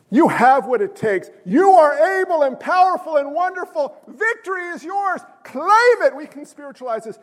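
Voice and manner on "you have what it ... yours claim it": building, high rallying hype; hammed-up inspirational speech, rising in pitch; motivational speaker. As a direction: pump up the crowd like a motivational speaker. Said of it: "you ever listen to a motivational speaker".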